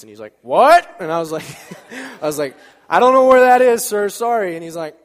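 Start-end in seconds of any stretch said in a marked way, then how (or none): 0.4-1.0 loud, high-pitched "what?"; hard-of-hearing shout. Retold or imitated. Old man cannot hear him. 2.9-4.5 loud, high raised-voice repeat; shouting to be heard. Retold or imitated. Repeats louder for old man.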